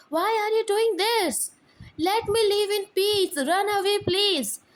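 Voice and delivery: high, loud desperate shout. Acted. Mother shouting at Kim to run away, loud. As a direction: yell at him to run, pleading. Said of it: "Mom shout".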